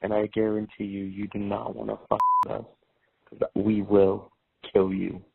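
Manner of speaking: ordinary talk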